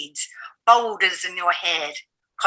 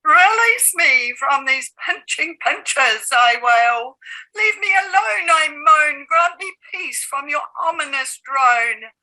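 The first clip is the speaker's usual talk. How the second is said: higher, pleading cry; anguished wail; 7 semitones higher, 13 dB louder than usual. Acted. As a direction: beg for release, rising. Pleading lines in poem.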